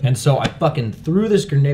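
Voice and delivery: ordinary talk, nothing marked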